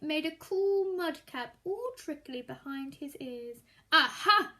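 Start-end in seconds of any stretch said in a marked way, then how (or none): 3.9-4.5 knowing aha. Acted. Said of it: "said the snake again".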